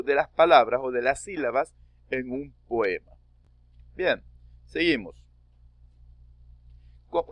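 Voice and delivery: usual speaking voice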